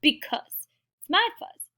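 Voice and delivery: high-pitched mock squabble; squabbling characters. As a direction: argue like bickering critters, pitched high. Acted squabble over fuzz.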